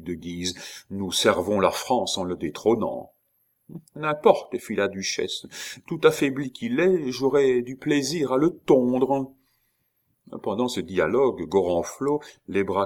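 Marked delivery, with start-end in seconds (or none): 3.9-4.6 high-pitched duchess voice; raised-pitch noblewoman. A narrator's reading. Duchess's voice in narrated dialogue. 5.9-9.3 high-pitched duchess voice; high voice, relishing the idea. A narrator's reading. Duchess's voice in narrated dialogue.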